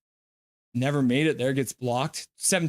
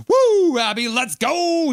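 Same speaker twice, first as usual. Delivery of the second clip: cheering whoop; 10 semitones higher, 6 dB louder, slower than usual. Retold or imitated. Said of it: "says G T Van City".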